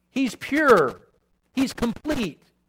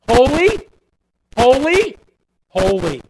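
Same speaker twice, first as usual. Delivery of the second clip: louder and higher, ringing proclamation; proclaiming; 4 semitones higher, 7 dB louder than usual. Acted. Preacher quoting seraphim to congregation, loud proclamation. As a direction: cry it out like angels in praise.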